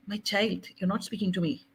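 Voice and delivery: soft and gentle. Retold or imitated. Holy Spirit correcting a distracted child, soft and gentle. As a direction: gently voice a loving inner prompting. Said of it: "Holy Spirit is very soft".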